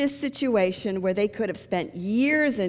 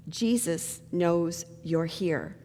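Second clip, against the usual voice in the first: slow, low, soft eerie warning; mysterious warning voice; 4 semitones lower, 5 dB quieter, much slower than usual. Acted. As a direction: act the unseen voice, slowly and quietly.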